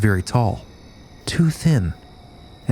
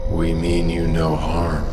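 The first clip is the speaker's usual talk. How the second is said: much lower, slow, smooth alien voice; perfect announcer voice; 7 semitones lower, slower than usual. Acted. Alien being telling the terrified couple, low and slow. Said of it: "such a perfect man's voice".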